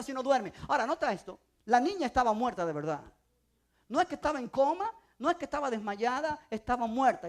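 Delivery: normal talking voice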